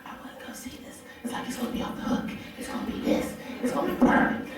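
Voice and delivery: hushed, breathy fan hype; eager fanboy. Retold or imitated. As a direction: imitate a giddy fan under your breath. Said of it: "he's a real diehard Dragon Ball fan".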